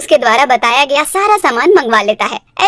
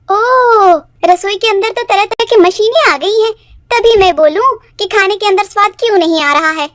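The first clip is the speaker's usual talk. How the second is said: sarcastic surprise; 5 semitones higher than usual. Acted. Sister-in-law taunts about kitchen machines.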